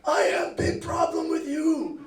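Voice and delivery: high shouted, slow broken-English landlady; mock the irate owner's accent, loud and slow; imitating an angry foreign homeowner. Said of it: "she's like".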